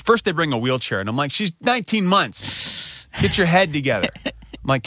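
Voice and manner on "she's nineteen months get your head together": high, incredulous protest; exasperated parent, played up; retold exasperation. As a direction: reenact disbelief, pitched way up. Retelling exasperation at hospital staff.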